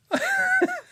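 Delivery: re-enacted loud burst of laughter. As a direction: burst out laughing, as if caught by the joke. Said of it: "and I always laugh".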